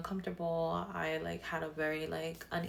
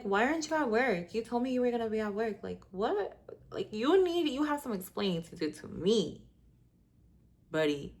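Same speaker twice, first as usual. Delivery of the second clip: louder, higher mock confrontation; confronting boyfriend; 4 semitones higher, 4 dB louder than usual. Catching boyfriend home from work, a retold louder confrontation. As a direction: demand answers from him, pitched up.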